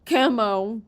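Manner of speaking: loud call with a rising end. Acted. Calling a name again.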